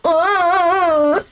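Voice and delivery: high, drawn-out groan; mock whining groan; imitated whine. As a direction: mimic her long whiny noise. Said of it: "She literally goes".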